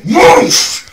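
a loud, slow, drawn-out whoosh of flames; whoosh sound. Retold or imitated. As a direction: blow it up big like fire catching. Fire bursts from the can.

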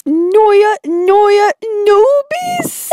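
failed repeat of nervous as "Noya, Noya, Noobies"; botched pronunciation attempt. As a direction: stumble from "Noya" up to a high "Noobies". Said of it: "Say it with me".

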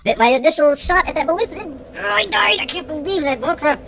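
high-pitched frustrated rant; mad. Real, not acted. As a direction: rant in worked-up disbelief. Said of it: "I'm so mad".

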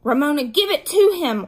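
forceful, exasperated order. A narrator's reading. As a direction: order a younger sister firmly and loudly. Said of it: "ordered Beezus".

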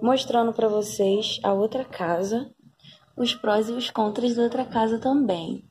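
ordinary talk

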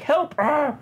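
high, slow, whimpering Hulk voice; a wounded brute pleading in a high whine; pitiful Hulk. Retold or imitated. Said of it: "And he's like".

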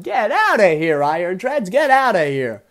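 high, loud commentator's yell; loud, high-pitched exclamation; excited shout. Real, not acted. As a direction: holler it at the battler. Shouting at Pokemon in battle.

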